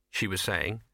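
ordinary delivery, nothing marked

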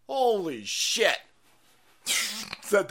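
usual speaking voice

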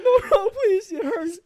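high whiny pleading; mock pleading. Imitating his younger self begging mom, high whiny plea. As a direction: whimper like a kid being hit.

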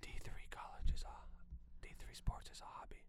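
soft whispered opinion. Real, not acted. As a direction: whisper it under your breath. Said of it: "say that with your chest".

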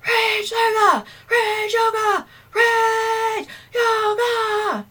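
repeated high metal screams. Pretend. Host hamming up rage yoga, metal screams. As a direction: wail it like a metal singer. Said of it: "I could actually be like".

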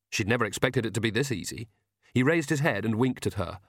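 plain reading voice

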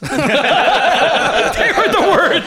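slow laugh through words; laughing out the punchline. Real, not acted.

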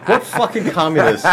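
high laughing indignation; giggling mock fury; laughing outrage. Real, not acted.

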